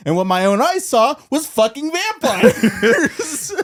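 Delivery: very high, loud, cracking into laughter; laughing rant. Retold or imitated. Fan finishing a Clooney impression, laughing loudly at the high-pitched punchline. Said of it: "George Clooney's like".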